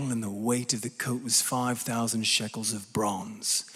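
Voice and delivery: lightly put-on British accent, low and measured. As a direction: read scripture in a low, hushed British voice. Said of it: "supposed to read this with a British accent".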